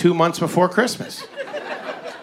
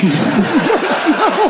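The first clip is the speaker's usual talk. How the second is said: slowed, pitched up, broken by laughter; laughing; 10 semitones higher, 4 dB louder, much slower than usual. Real, not acted. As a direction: laugh through the aside. Laughing aside.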